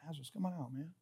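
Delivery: very soft, low, offhand murmur; casual low mumble. Acted. Preacher showing how Jesus could have said it, casual and hushed. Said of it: "He could have said".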